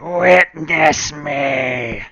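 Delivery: slow, drawn-out loud creepy chant; creepy acolyte voice. Acted. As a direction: stretch each word like a cultist's call. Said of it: "we get to do it again".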